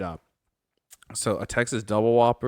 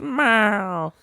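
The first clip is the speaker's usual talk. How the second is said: high, drawn-out meow; cat meowing; 9 semitones higher than usual. Retold or imitated. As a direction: stretch out a nagging cat's meow. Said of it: "just meowing".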